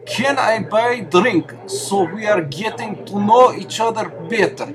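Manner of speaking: slow, high, thick fake Russian accent; bad Russian accent. Volunteer delivering cheap pickup lines in a bar, bad Russian accent. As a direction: lay on a hammed-up Russian accent, drawn out and high. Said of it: "delivered in bad Russian accent".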